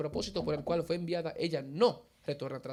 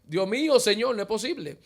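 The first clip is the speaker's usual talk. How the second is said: loud and higher, a burst of astonished disbelief; shocked disbelief; 5 semitones higher, 13 dB louder than usual. Retold or imitated. Son stunned that his mother fell, loud disbelief. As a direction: blurt it out, stunned and loud, calling on God. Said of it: "Digo yo".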